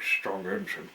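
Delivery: ordinary delivery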